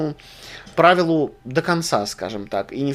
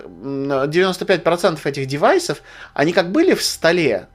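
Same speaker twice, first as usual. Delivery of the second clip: usual speaking voice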